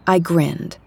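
usual speaking voice

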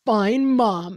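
put-on loud, sulky kid reply; sulky tween. Son sassing his mom at dinner, loud and sulky. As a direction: throw it back like a fed-up tween.

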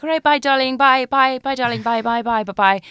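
high, loud sing-out of endless byes, easing off; London phone goodbye. Retold or imitated. Guest mimicking Londoners hanging up, high repeated goodbyes. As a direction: mimic a Londoner who cannot stop saying bye. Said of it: "I hear it's, like, on the street walking".

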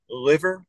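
LIVER with a slow back-of-tongue L; dark, drawn-out L opening "liver"; North Jersey L. Retold or imitated. Co-host tries North Jersey words on a doubtful partner, back-of-tongue L. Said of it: "do all North Jerseyans say".

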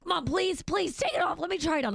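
put-on high pleading kid voice; whiny begging. Son begging mom for her sweatshirt, high and whiny. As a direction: beg like a teen who wants the hoodie. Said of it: "He's like".

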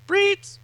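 very high, loud mock alarm; shrieked alarm noise. Alarm buzzing at monitor duty, high imitated beep.

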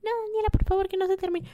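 high, pleading whine; playful plea to herself; mock pleading squeal. Real, not acted. Begging the segment never ends.

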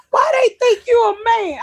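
put-on high, childlike question; imitating a nosy kid, high-pitched; kid's voice. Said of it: "the kid was like".

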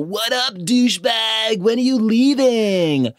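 hammed-up dudebro greeting his buddy; bro voice. Mocking Trevor greeting his friend on FaceTime, hammed-up high bro voice. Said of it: "he's all".

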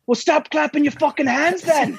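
high, mocking Irish heckle; heckler's Irish accent. Heckler yelling back at [name] in an Irish accent. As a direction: shout it back like a cheeky Irish fan. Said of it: "ridiculous accent".

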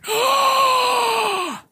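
long, very high mock gasp; imitated shocked gasp. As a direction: suck in air like a horrified onlooker. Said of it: "he was just like".

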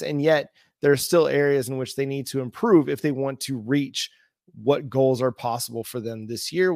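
ordinary talk, nothing marked

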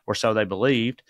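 normal talking voice

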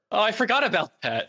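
ordinary delivery

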